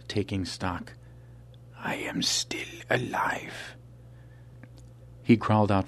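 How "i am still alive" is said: slow breathless whisper, barely there; shaky whisper. Acted. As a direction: whisper it as if surprised to be alive. Dying curator whispers alone.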